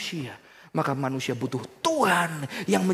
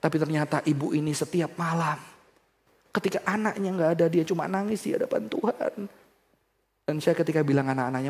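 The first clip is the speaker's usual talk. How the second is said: normal talking voice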